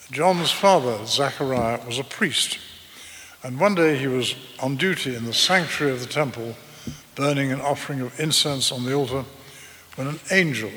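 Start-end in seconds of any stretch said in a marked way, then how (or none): none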